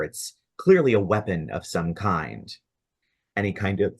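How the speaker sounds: normal talking voice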